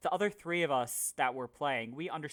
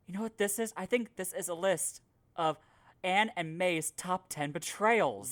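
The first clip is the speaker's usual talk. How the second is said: announced punchline; 5 semitones higher, 4 dB louder than usual. Retold or imitated. Players spring the pun at the dungeon's end, announced punchline. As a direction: deliver the pun as a big reveal. Friends quote the pun reveal.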